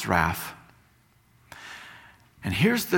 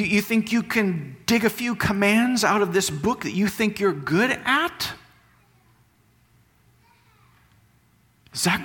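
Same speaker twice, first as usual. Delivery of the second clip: higher, pressing rhetorical questions; fervent preaching; 8 semitones higher than usual. Acted. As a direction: challenge the congregation, pushing each question up. Preaching at full force, rhetorical questions.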